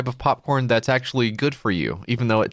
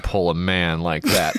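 ordinary delivery

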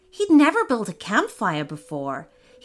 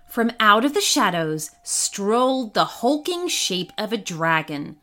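usual speaking voice

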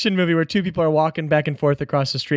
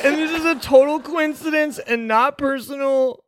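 high, formal proclaiming voice; town crier; 9 semitones higher than usual. Acted. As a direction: read the decree like a herald. Crier announces new law.

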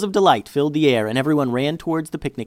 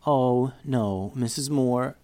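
sobbing; slower than usual. Acted.

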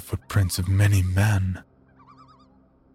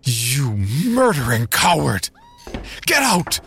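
furious shout; 5 semitones higher than usual. Acted. Narrator shouting at the priest to get out, furious. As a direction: throw him out with rage. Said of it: "I shouted".

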